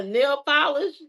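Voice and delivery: ordinary delivery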